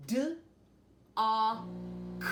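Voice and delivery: D, A, K as separate sounds, D loud; tapping out sounds. Shown as a demonstration. Teacher sounding out dock for the class, sound by sound. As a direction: tap out D, A, K one sound at a time. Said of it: "tap out the sounds in dock".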